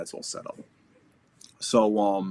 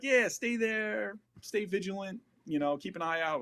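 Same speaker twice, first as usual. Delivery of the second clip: imitated commanding officer; 11 semitones higher than usual. Commanding officer telling stranded soldiers to stay, mock casual orders. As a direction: mimic the boss in a lofted, easy singsong.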